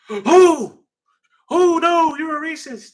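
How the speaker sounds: very high, exaggerated mocking gasp of horror. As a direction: start loud and high, hammed-up shock. Mimicking easily offended people.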